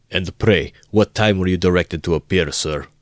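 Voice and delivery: loud, indignant official shout. A narrator's reading. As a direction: bark it at the late student. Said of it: "shouted the assistant superintendent".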